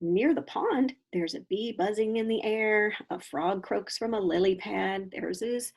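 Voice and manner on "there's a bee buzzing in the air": ordinary delivery, nothing marked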